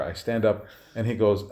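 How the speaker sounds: ordinary delivery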